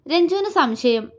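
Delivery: usual speaking voice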